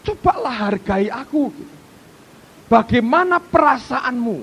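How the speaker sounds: usual speaking voice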